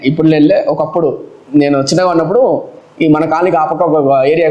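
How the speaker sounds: ordinary talk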